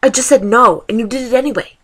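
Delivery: ordinary talk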